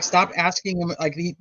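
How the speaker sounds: ordinary talk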